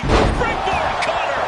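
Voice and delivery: high, slow excited yell; excited move call. Real, not acted. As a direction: call it out big. Commentator calls the move.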